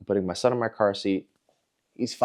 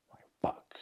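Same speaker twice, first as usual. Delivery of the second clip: hushed, whispered retold dismay; whispered curse; 14 dB quieter than usual. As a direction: swear under the breath. Retold silent regret after yelling.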